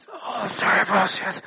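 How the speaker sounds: put-on poorly croak to the boss, hammed up; fake sick voice. Worker phoning the boss to skive, mock sick voice. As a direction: sound pitifully ill on the phone. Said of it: "you've got that sick voice".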